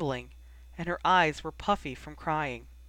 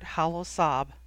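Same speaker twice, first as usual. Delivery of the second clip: usual speaking voice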